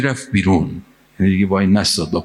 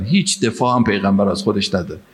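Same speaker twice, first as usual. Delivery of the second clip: usual speaking voice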